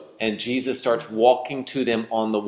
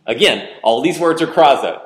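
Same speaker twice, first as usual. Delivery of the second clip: usual speaking voice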